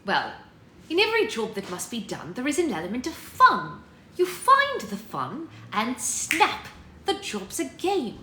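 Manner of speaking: lilting, theatrical British nanny accent. Shown as a demonstration. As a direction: play Mary Poppins with a British accent. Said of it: "British accent".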